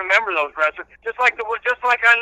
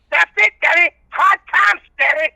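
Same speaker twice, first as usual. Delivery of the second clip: Dusty Rhodes impression; 9 semitones higher, 4 dB louder than usual. Caller doing Dusty Rhodes for the host, lisping higher and louder. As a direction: do Dusty with the lisp. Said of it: "I've done it so much on the show".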